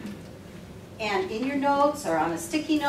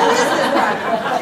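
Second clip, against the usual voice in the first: exasperated joking quote; 6 dB louder than usual. Retold or imitated. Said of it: "so we lightly say".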